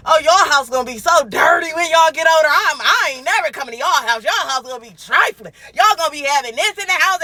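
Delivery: loud, high mock-scolding mom; imitating her mom's loud scolding. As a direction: nag like a fed-up mother. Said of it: "my mom used to always tell".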